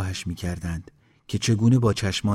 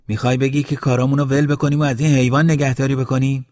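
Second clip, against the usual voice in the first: fast, loud, higher-pitched objection; raised voice, rushing through the complaint; indignant protest; 6 semitones higher, 7 dB louder, faster than usual. A narrator's reading. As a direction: rattle off an incredulous challenge. Villager objects to caring for creature.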